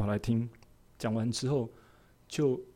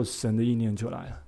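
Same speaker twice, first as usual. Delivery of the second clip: normal talking voice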